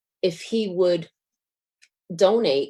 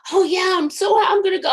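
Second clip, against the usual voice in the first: high, quick and bouncy; all keyed up to go; eager chirp; 14 semitones higher, much faster than usual. Retold or imitated. Heading for the chemo rooms, eager and high.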